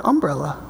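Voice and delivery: "umbrella" stressed on the first syllable; Southern-style "umbrella", accent moved to the front. Retold or imitated. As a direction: hit the UM in "umbrella". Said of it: "Southerners put the accent".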